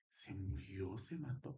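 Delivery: hushed, breathless telling; whispering to cousin the shocking news; trembling whisper. Retold or imitated. Pero yo temblando.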